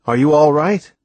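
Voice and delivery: slightly odd, rising question; funny voice. A narrator's reading. Sailor asks the blind boy, in a funny voice. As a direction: ask it in a mildly funny voice. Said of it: "In a funny voice".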